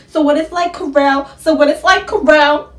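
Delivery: demanding. Retold or imitated. Demanding answers from boyfriend.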